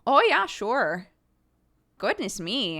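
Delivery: loud, high Minnesota mom accent; chirpy Minnesota mom, stretched vowels. Shown as a demonstration. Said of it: "I had to learn the Minnesotan accent".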